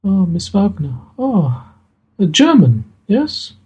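a touch of a shy, thankful daughter; voiced Maria.

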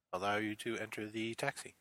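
normal talking voice

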